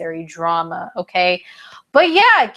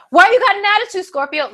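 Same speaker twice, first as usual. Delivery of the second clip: higher, louder, confrontational; mock accusing; 10 semitones higher, 4 dB louder, faster than usual. Stranger accusing Scorpio of attitude, high and loud. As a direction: call them out, heated. Stranger escalates acted quarrel.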